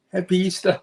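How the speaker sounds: "happy Easter" as "heppy Eastah" in New York accent; thick New York accent, "heppy". Shown as a demonstration. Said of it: "in our New York accent".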